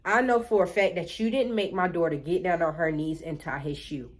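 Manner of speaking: a hint of mama standing firm; mama's voice. Mama confronting the teacher, low firm voice. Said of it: "My mom like".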